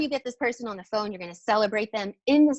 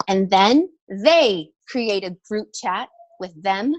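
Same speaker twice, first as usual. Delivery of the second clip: ordinary talk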